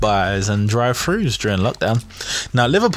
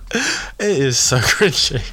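slow, higher, through laughter; laughing; 4 semitones higher, slower than usual. Real, not acted. Host cringing at a picture of cat costumes, laughing. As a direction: let a real laugh break into the words.

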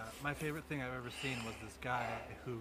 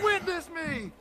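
high, loud movie-maniac war cry; hammed-up screamed battle cry; screaming; 14 semitones higher, 8 dB louder, slower than usual. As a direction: scream it out like a crazed road warrior. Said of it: "Just screaming down the".

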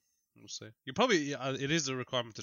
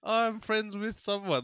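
mock English accent; 9 semitones higher, much slower than usual. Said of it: "the English accent one".

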